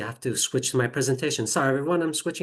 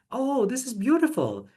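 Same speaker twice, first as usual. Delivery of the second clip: higher-pitched, pleased "oh"; delighted admiration; 9 semitones higher than usual. Real, not acted. Teacher showing the AI's simplified texts to colleagues, delighted.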